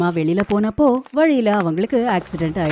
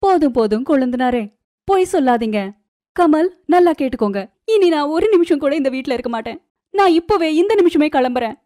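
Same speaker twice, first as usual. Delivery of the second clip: high-pitched, indignant threat to leave; angry outburst; 8 semitones higher than usual. Acted. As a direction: cut the brother-in-law off angrily, voice raised. Acted quarrel, threatens to leave. (கோபமாக உயர்ந்த குரலில்)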